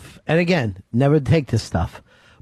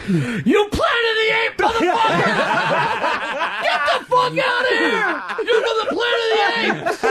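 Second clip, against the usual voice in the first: imitated yelling. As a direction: scream it like a freaked-out friend, no extra volume. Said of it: "and he just yelling".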